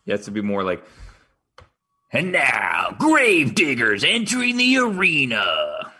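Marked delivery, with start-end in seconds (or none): 2.1-5.9 slow booming arena shout; monster truck announcer. Shown as a demonstration. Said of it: "monster truck promo voice is more gritty".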